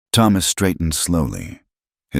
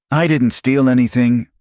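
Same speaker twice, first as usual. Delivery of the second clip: a loud, high outburst of anger; bursting out; 9 semitones higher, 6 dB louder than usual. A narrator's reading. Abigail to Miriam, finally bursting out in anger. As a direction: let months of anger break free. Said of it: "the words burst out".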